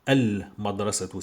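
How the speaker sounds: "Al-Madrasatu" with a clear held L; al-madrasatu, L fully heard; L pronounced. Shown as a demonstration. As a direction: sound the L in "Al-Madrasatu". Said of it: "je dis".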